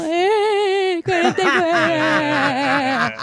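put-on unsure child's answer. A child unsure of the answer under a shouting mother, slow and hesitant. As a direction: stretch the number out like a scared kid guessing. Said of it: "Y uno".

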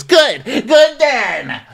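very high, loud comeback; mock retort. Acted retort in a quarrel.

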